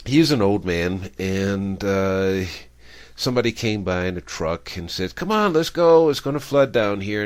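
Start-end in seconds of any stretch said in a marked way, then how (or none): 5.2-7.2 raised, high-pitched urgent call; urgent shout. Acted. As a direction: call out urgently, higher and louder. Truck driver calling out to old man.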